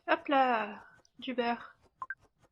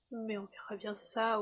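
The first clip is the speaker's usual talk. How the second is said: ordinary talk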